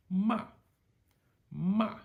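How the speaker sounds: falling tone on ma, punchy and loud; sharp falling fourth tone. Shown as a demonstration. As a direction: drop ma sharply like a command. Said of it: "High to low, sharp falling tone".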